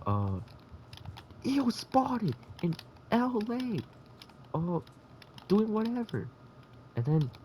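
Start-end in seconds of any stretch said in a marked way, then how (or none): 1.4-6.3 very high, slow, sneering gossip; high mock-disgusted celebrity gossip; snooty gossip voice. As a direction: drawl it like a catty gossip account.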